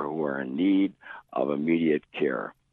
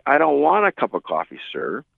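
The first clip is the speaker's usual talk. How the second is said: loud, much higher refusal; irate; 10 semitones higher, 6 dB louder than usual. Retold or imitated. Guest turns down coffee a second time, loud and irate. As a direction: bark the refusal at him.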